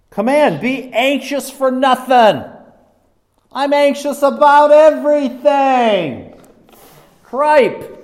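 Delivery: loud, high, drawn-out mock despair; exasperated outburst. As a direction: cry it out in comic exasperation. Acts out his own anxious exasperation.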